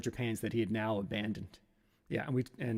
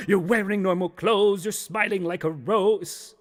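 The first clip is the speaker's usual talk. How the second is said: slow, loud, higher chant; accusing phantom chorus; 6 semitones higher, 6 dB louder, slower than usual. Acted. Beer, pizza and pants reproach Steve, accusing chorus. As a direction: draw each accusation out theatrically. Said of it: "And they all go".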